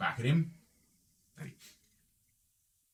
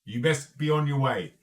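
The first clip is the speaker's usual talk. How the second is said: loud, higher in-character warning; firm, loud gang boss; leader's warning; 4 semitones higher, 8 dB louder than usual. Acted. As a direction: send intruders away with force. Leader warns the players away.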